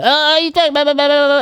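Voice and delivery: high mocking voice of people trashing the episode; mocking critic. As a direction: parody the naysayers.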